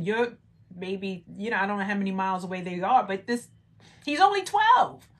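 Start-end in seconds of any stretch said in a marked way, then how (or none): 4.0-5.1 high, loud exclamation; incredulous. Real, not acted. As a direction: stress it in loud, high disbelief. Disbelief at missing twelve-year-old son.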